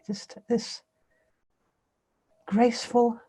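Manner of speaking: measured meditation guidance with long pauses; guided meditation. Real, not acted. Teacher leading listeners to scan the body with awareness, guided meditation. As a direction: guide them through a body scan, unhurried.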